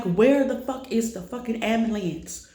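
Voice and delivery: half-yelling it again like on the phone; retold frantic yell. To the 911 operator, a retold frantic angry yell.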